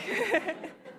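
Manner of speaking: a short high laugh that breaks out mid-story; tearful laugh. Real, not acted. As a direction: laugh through emotion, high and light. Laughs while telling of crying.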